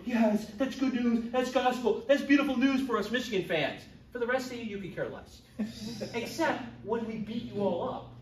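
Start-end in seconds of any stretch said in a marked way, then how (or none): 0.0-3.8 higher, louder, slowed cheer of delight; thrilled fan cheer. Retold or imitated. Michigan fan cheering with his son over the coach news, delighted. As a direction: savor the good news like a happy fan.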